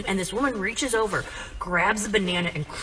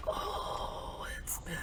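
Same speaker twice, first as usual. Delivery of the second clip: slow, barely voiced mock admission; whispered confession; 15 dB quieter, much slower than usual. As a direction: whisper it weakly like a spent drunk. Drunk dad's tiny confession.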